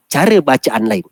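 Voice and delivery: ordinary delivery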